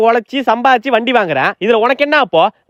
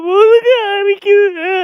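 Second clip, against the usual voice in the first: put-on high slow wailing baby cry, much higher; 13 semitones higher, much slower than usual. Baby bawling to the parents about his back. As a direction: bawl it out like a baby. Adult playing crying baby.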